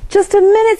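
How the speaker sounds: high, bright call to stop him. A narrator's reading. Grandma calling Signor Calavera back, a high call.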